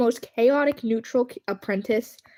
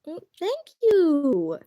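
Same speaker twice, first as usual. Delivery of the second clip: high slow gushing thanks; 6 semitones higher, 3 dB louder, much slower than usual. Real, not acted.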